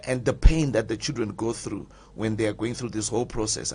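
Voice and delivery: normal talking voice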